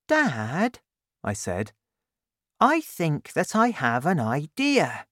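put-on eager boy asking his dad; young Danny. Danny in bed, voiced high.

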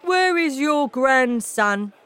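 loud, high, slow angry father. A narrator's reading. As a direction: demand an answer, loud and drawn out. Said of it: "looking very angry".

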